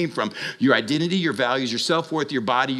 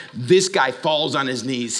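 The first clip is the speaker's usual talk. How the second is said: ordinary delivery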